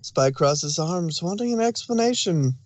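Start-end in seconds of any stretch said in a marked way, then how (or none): none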